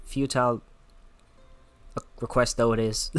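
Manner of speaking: put-on a light Scottish accent for Lena. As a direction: read her lines in a gentle Scottish brogue. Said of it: "Lena has a Scottish accent".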